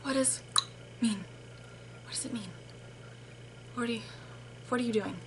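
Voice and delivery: very quiet, halting puzzlement; hushed confused asides; baffled muttering. Retold or imitated. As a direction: mutter to yourself, totally lost. Baffled inner reaction to customer.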